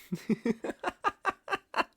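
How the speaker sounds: high, breathy villain giggle; a teasing, high-pitched titter; mocking giggle. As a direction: giggle at her ignorance. Villain mocks guest.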